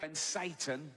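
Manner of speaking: ordinary delivery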